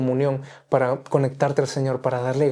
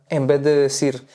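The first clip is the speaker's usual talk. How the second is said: ordinary delivery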